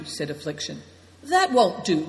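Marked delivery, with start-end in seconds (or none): 1.2-2.1 higher, firm objection; crying out. A narrator's reading. The host refusing to let the wounded man walk, crying out. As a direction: overrule him at once. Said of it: "cried Nai Dong".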